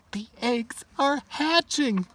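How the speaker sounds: high put-on voice; character voice. Friend voice.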